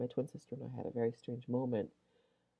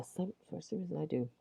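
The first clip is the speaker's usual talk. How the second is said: ordinary talk, nothing marked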